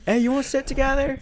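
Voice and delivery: high, fast, tentative ask; mock shy invitation. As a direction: nervously ask a stranger to sit with you. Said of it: "were you like".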